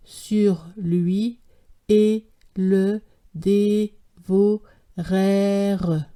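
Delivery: very slow, solemn schoolgirl reading; slow, serious, word-by-word reading; grave child reading. A narrator's reading. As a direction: read it gravely and slowly like a little pupil. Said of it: "continuait sa lecture d'un air grave".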